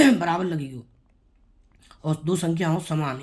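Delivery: usual speaking voice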